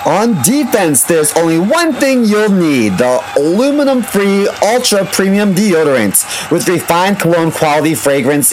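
upbeat sponsor read, sports-announcer energy. Acted. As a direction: sell it like a game-day hype reel.

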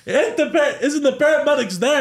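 very high-pitched bewildered query; incredulous question. Retold or imitated. Cousin asked for a ride after crash, incredulous. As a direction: imitate a baffled friend, voice shooting up.

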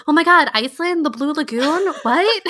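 high, loud burst of amazed excitement; loud mock-stunned outburst; freaking out. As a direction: re-enact an excited freak-out, pitch jumping up. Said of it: "we did kind of freak out".

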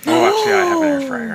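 high, fast gush of delight; sudden excited squeal; excited burst. Real, not acted. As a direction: blurt it out, thrilled. Sudden gushing about air fryer.

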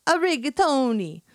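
rigatoni said with a hammed-up Italian lilt; a rigatoni, high and loud Italian style; Italian accent. Enzo's word, in a mock Italian accent. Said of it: "as he calls it".

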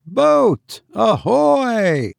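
high, long sailor's hail. A narrator's reading. Will hails the unseen boat, hailing. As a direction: hail a boat far across water. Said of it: "to his lips and hailed".

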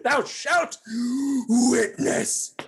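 loud, high theatrical declaration; hammy stage actor. Acted. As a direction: declaim it like a Victorian hero. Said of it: "Overacting, not a thing".

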